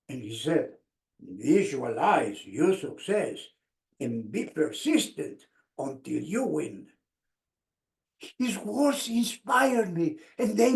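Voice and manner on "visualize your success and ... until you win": coach's wise advice. Retold or imitated.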